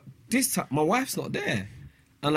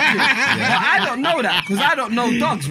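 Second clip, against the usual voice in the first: laughing protest; 5 semitones higher, 6 dB louder than usual. Real, not acted. Defends himself while laughing.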